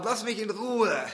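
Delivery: high, loud shout. Retold or imitated. People fleeing from God yell at him, angry shout. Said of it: "schreien ihm an".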